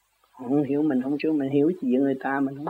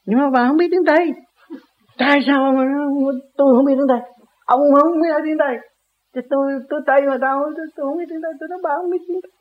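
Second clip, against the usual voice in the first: heated mock argument; 9 semitones higher, faster than usual. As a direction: replay the spat, voice up high. Heated retold argument, both voices. (cãi nhau giọng cao, to)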